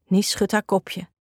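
ordinary delivery

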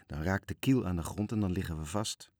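ordinary delivery

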